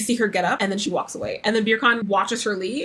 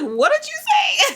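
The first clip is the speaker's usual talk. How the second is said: high, drawn-out incredulous question; mock disbelief; slower than usual. Host voicing the wife asking him to repeat it, mock disbelief. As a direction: imitate someone stunned by what they heard. Acts out Laura's stunned question.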